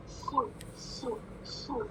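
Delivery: सु whispered, soft and hissing; whistling su. Shown as a demonstration. Teacher demonstrates the heavy whistling letter. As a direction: breathe सु as a whistle sound. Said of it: "मोटी सिटी वाली sound".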